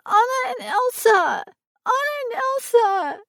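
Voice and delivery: put-on high, loud toddler demanding; tantrum child. A narrator's reading.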